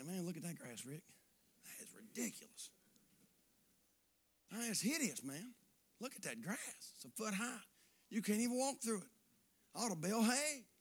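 low, hushed disgusted muttering; mock grumbling. Preacher mimicking himself grumbling at his lawn, hushed mutter. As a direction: mutter at the lawn like a fed-up homeowner.

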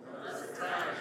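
hushed whisper, no voice; breathy stage whisper to the crowd. Shown as a demonstration. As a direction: whisper it like a secret. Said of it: "Can I get somebody to say".

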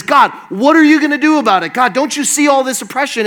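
fast, loud, high-pitched complaint; urgent protest. Acted. Gideon demanding that God act on oppression, urgent protest. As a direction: fire off the accusation high and quick.

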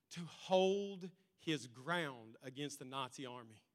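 ordinary talk

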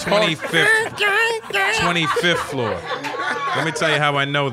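can't stop cracking up while talking; laughing. Real, not acted. Caught knowing a stranger's hotel floor, laughing.

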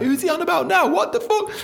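high, baffled voice; very high mock confusion; confused new listener. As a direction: sound lost and puzzled. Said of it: "'cause you're like".

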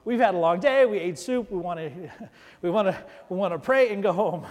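mock tired congregation. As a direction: voice the tired crowd begging to go home. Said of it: "and I know you're like".

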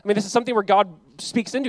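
ordinary delivery

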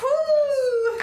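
very high, loud "woo"; celebratory whoop. Real, not acted. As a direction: whoop in real triumph, high and long. Player cheers a narrowly passed save.

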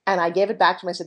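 normal talking voice

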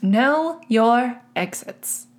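slow, deliberate sign-off; careful sign-off. Shown as a demonstration. Host slowly nailing the show catchphrase this time. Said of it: "I got it right that time".

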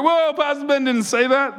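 mock-scandalised congregant; mock shock. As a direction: play a shocked listener.

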